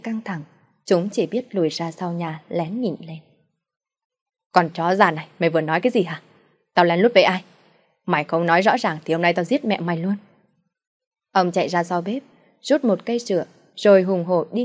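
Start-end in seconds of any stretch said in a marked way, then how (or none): 4.5-10.2 scare her into answering; threatening father. Acted. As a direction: drunk husband threatening to kill his wife, furious.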